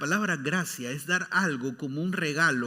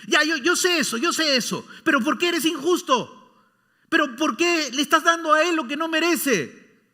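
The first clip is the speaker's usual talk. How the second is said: loud, higher protest; indignant son; 10 semitones higher, 8 dB louder than usual. Acted. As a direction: complain hard that it's unfair. (hijo indignado, reclamo fuerte y agudo)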